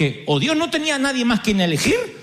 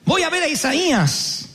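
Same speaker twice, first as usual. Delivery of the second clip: ordinary delivery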